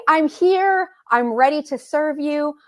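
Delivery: usual speaking voice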